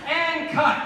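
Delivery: high excited call of cut. Retold or imitated. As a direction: shout it like a director on set. Calling cut on set.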